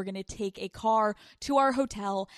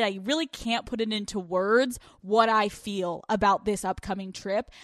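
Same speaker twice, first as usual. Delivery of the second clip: ordinary delivery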